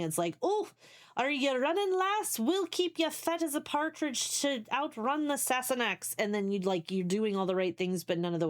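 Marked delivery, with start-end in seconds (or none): 0.4-6.1 high, hammed-up Scottish accent; terrible Scottish accent. Streamer acts out a heritage meme, terrible Scottish accent. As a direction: play a jokey Scottish granny in a bad Scottish accent. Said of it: "that was a really terrible Scottish accent".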